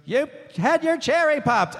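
imitated female fan. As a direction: lift the pitch as a cheeky audience member. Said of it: "and she goes".

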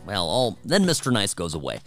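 usual speaking voice

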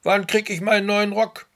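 high, loud impatient emperor; impatient demand. Acted. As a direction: demand it, fed up with waiting. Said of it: "klopft ungeduldig mit dem Stock".

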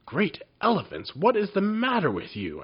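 high, loud cry of amazement; amazed wizard. A narrator's reading. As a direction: gasp it out in astonishment. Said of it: "asked the wizard in amazement".

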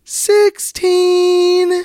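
counting reps while straining; strained counting, pitch climbing up; doing push ups. Real, not acted. Damn it.

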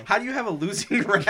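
high, quick words through real laughter; helpless laughing disbelief; laughing incredulously. Real, not acted. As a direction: crack up while asking, pitch climbing. Cracking up at losing rallies.

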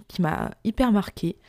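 normal talking voice

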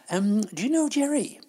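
put-on fussy, gossipy old dear, fluttering up and down; chatty old lady Doris. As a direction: play a prim, scatterbrained pensioner scolding the host. Performs the Doris character throughout.

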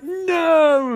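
high, long drawn-out wail; hammed-up cry of grief; mock anguished cry. Husband at his wife's death, mock anguish. Husband cries as wife dies.

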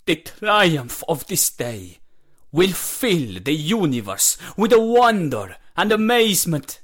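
loud, high, sweeping oratory; proclaiming master. A narrator's reading. Master stepping back before the launch, proclaiming triumph.

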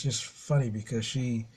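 ordinary talk, nothing marked

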